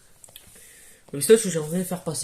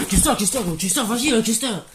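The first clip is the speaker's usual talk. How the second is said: tic crié; 3 semitones higher, 4 dB louder than usual. Acted. Tic de Tourette joué.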